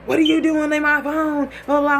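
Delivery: indignant, high-pitched mock yelling; flipping out. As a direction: mimic him losing it. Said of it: "He's flipping out".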